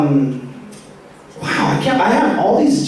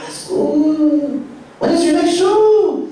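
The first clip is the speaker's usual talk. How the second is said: high-voiced supportive uncle; 8 semitones higher, slower than usual. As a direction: raise your pitch like a keen homeboy uncle. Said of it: "he totally talks like that".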